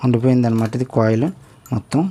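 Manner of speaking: usual speaking voice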